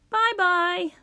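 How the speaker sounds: high, airy goodbye; singsong farewell. A narrator's reading. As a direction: toss off a high-pitched farewell. Bach cheerily leaves grumpy town.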